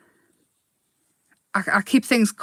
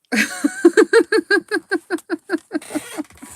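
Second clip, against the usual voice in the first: long high giggle; giggling laugh; 10 semitones higher than usual. Real, not acted. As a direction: laugh with delight.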